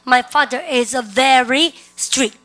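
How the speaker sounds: deliberate, firm teacher's drill; repeat-after-me model. Shown as a demonstration. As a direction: lead the class word by word. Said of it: "시작".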